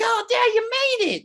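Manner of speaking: imitated delighted children; excited kids. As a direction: squeal it like kids greeting their dad. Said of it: "the kids are like".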